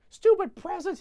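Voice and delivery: put-on very high, loud angry kid; mock tantrum. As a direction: scream it like a furious child. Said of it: "he goes".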